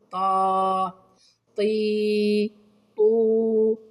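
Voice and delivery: "طا، طي، طو" as separate, steadily held syllables; long-vowel demo. Shown as a demonstration. Teacher showing pupils the letter with madd, long-vowel demo. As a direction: hold each vowel long on one level note. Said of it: "هذا صوت حرف الطاء مع مد الألف".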